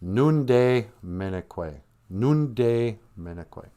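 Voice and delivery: "Nunde minekwe" said slowly and clearly; Ojibwe word demo. Shown as a demonstration. To the kids, teaching the word for thirsty, slow. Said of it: "the word for thirsty is actually".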